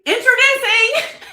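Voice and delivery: a loud, high announcement bursting into laughter; dramatic flair. Real, not acted. Host finally unveiling her book title, laughing. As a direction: go big on the reveal and laugh through it. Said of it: "I gotta give it a little dramatic flair here".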